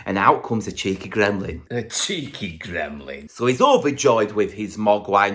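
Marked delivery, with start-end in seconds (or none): 1.7-3.3 slow, low, soft menace; slow low hushed menace; creepy aside. Acted. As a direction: purr it like a sinister aside.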